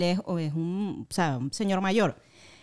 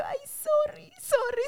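much higher, softer flustered sorry; embarrassed apology; 3 dB quieter, slower than usual. Retold or imitated. Apologizing to the stranger she mistook, high and flustered. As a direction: apologize in a mortified hurry.

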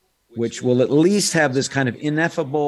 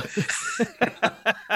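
high laugh; 11 semitones higher, 3 dB quieter than usual. Real, not acted. Laughs at his own refusal.

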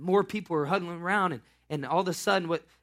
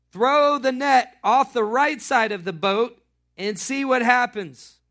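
loud, slow, higher call across the water; 7 semitones higher, 10 dB louder, slower than usual. Acted. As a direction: call to the boat, slowly. Jesus calling from the beach.

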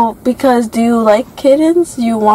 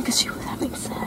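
quick whisper; fast, hushed gossip; 16 dB quieter, faster than usual. Real, not acted. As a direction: rush it out in a secretive whisper. Confiding a cat story.

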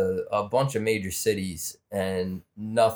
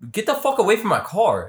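retold yell; 12 semitones higher, 4 dB louder than usual. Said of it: "And I'm like".

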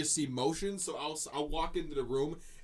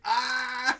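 mock surprised gasp; 9 semitones higher, 6 dB louder than usual. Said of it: "he was like".

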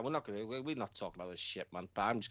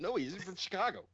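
laughing mock reply; 10 semitones higher than usual. Mocking battle rap exchange.

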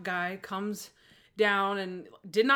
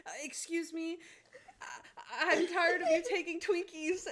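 lightly voiced prissy student, high; hint of a whiny teacher's pet; goody-goody voice; 8 semitones higher than usual. Imagined goody-goody with note cards, lightly mocking and high. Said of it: "I'd be like".

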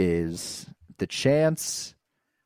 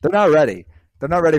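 hype shout; 6 semitones higher, 10 dB louder, faster than usual. Real, not acted. Hyping his team's big game.

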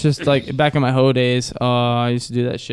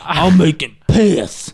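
very slow, drawn-out silly voice; slow comic impression; TikTok character voice; 4 dB louder, much slower than usual. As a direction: drag the words out in a put-on TikTok voice. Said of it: "What was that voice?".

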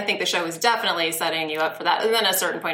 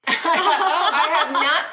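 laughing; 7 semitones higher, 3 dB louder, much slower than usual. Real, not acted. Laughing at own mistake.

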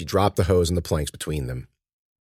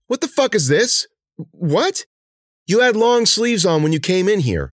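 shaking with anger; 13 semitones higher, 4 dB louder than usual. Acted. Cece finds the wound.

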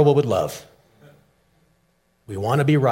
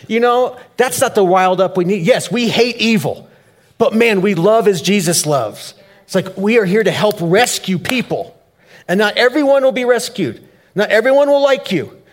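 preaching at full force; 7 semitones higher, 5 dB louder than usual. Real, not acted. Passionate sermon climax.

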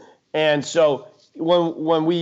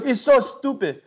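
lightly imitated Indian accent, pitched higher; 7 semitones higher than usual. Quoting an unimpressed friend to the co-hosts, light Indian accent. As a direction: quote your friend in a hint of Indian accent. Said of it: "He looks at me in an Indian accent".

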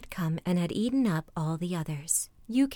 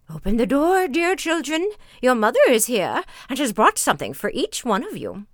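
loud, raised, lightly voiced wolf posing as mother; wolf's rough voice; 5 semitones higher, 8 dB louder than usual. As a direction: call out as a wolf badly faking mother. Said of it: "knew from the rough voice".

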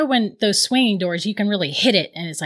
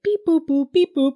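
high, chirpy beep-boop robot noises; a light imitation of a boy's robot beeps; little robot noises; 6 semitones higher than usual. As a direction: play a kid making toy robot sounds. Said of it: "he's making these little robot noises".

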